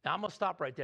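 usual speaking voice

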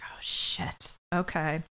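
imitating a shocked whisper. Voicing Munch's embarrassed reaction, whispered. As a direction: breathe it out, caught off guard. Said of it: "Munch makes that face like".

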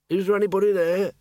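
muffled call through a wall. Acted. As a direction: call out from behind a closed door. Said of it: "a muffled voice called out".

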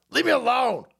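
hammed-up indignant sputtering, pitched high; mock a flustered denial, stammering into a shout. Host mocking Bill Clinton asked about the island, hammed-up indignant stammer. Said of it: "he got all indignant".

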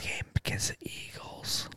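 breathy stage whisper, mock secret. Whispering secret date aside.

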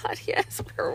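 hushed, grateful whisper to yourself; whispered relief. Retold or imitated. Mom nearly at kids' bedtime, relieved whisper. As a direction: whisper a little private celebration.

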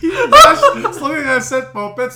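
high laughing tease, words through giggles; cracking up while teasing a friend. Real, not acted.

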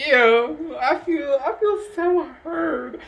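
high whiny fake sob; mock crying. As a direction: wail it like a hurt child. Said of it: "I was like".

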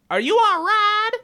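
high, slow, slurring; sway it like a tipsy partier; drunk girl voice. Retold or imitated. Drunk bachelorette girl asking.

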